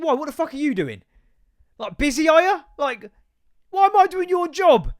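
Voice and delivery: rising to a near shout of disbelief; exasperated rant. Retold or imitated. Ranting at lazy shopkeeper in head.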